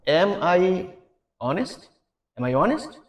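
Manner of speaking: HONEST rising at the end like a real question. Shown as a demonstration. Teacher models question tone for learners, questioning, rising on HONEST. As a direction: ask it, lifting the pitch on HONEST. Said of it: "জিজ্ঞাসা করার মতো বিষয়টা যেন থাকে".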